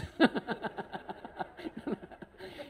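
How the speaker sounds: high, soft wheezy chuckle; laughing. Real, not acted. Speaker amused by his own marriage joke, soft high giggling. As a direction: giggle helplessly under your breath. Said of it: "kenapa saya ketawa terus".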